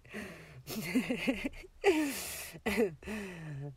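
long, giggly laugh; amused laughter. Acted. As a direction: laugh it out, then catch your breath. Amused at partner asking for coffee.

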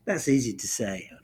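normal talking voice

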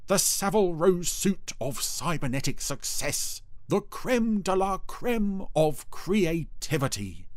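pompous, offended boasting; huffy Dougal. A narrator's reading. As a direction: swell to a slow, high flourish. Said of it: "said Dougal huffily".

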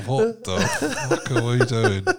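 slow, baffled mock muttering; playing the lost player in the huddle; bewildered player. Player in the team circle, slow and baffled, half laughing. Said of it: "they're all looking at each other like".

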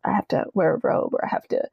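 hushed whisper of the house rule; half-whispered confession. Retold or imitated. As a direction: say it under your breath like a secret. Confiding family rules.

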